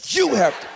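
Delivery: YOU thrown out high and loud; forceful group cue. Shown as a demonstration. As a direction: lead the shout with a hard "You". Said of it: "Say it with me".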